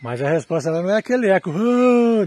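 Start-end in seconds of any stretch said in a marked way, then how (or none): 1.5-2.2 canyon echo. Real, not acted. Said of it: "Não, é um eco assim".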